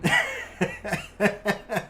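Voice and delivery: breathless laugh. Real, not acted. Laughing after the outro.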